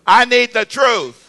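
loud, drawn-out line for repeating; emphatic call-out. Shown as a demonstration. Pastor asks the crowd to repeat after him, emphatic call-out. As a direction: call it out slowly and firmly.

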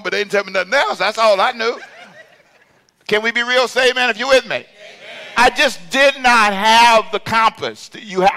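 loud, high, impassioned testimony; high-pitched preacher's shout; full-force preaching. Real, not acted. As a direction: preach it out to the whole congregation.

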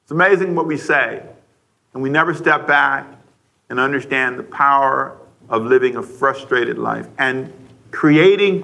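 ordinary talk, nothing marked

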